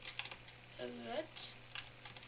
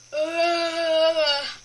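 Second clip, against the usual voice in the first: loud weary groan; exhausted groan; 9 dB louder than usual. Real, not acted. Gamer groaning in relief after the level. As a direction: let out the relief.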